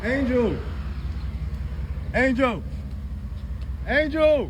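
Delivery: teasing call after the departing woman. Real, not acted. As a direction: call her name after her as she walks off. Calling out after leaving woman.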